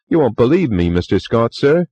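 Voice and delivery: put-on chatty young bellboy, eager to please; Cockney bellboy. A narrator's reading. Bellboy Thomas voiced.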